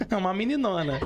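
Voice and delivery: chuckling playful jab; amused teasing with a laugh; laughing tease. Real, not acted. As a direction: laugh through the words, teasing. Teasing about the 52-year-old girl.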